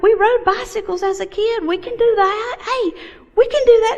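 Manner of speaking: talking husband into it; lively high-pitched eager pitch; eager enthusiasm. Retold or imitated. Eager to do triathlon.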